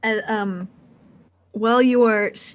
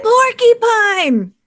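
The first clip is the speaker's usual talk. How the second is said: loud, high, drawn-out showman cry; hammy punchline shout; 8 dB louder, slower than usual. Acted. Pigman performer delivers a joke's punchline mid-fight, high hammy shout. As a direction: belt the punchline out slowly to the crowd.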